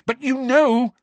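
very high, loud shout; high loud accusing shout. Acted. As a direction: accuse the elf loudly. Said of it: "shouted Harry".

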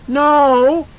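put-on high loud child's cry; frightened cry. A narrator's reading. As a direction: shout no in fear.

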